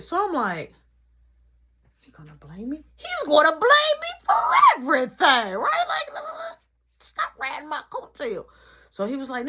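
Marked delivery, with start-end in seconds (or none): 2.0-2.8 soft, under-the-breath question; quiet disbelief. Acted. Blamed for ordering the pizza, quiet disbelief. 3.0-8.4 high, loud mock outrage; indignant outburst. Blamed by brother for the pizza, indignant outburst.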